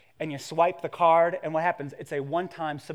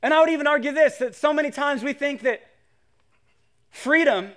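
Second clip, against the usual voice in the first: high, raised sermon voice; impassioned preaching; 8 semitones higher, 6 dB louder than usual. Real, not acted. Preacher telling the church about God's future, impassioned preaching. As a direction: preach it with force to rouse the room. Preaches to the congregation at full force.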